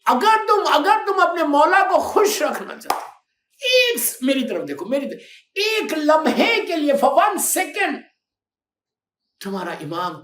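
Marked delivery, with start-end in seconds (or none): 0.1-8.0 loud, high fervent preaching; impassioned sermon cry; preaching shout. Real, not acted. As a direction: call out to the crowd with fire. Preacher exhorting the youth.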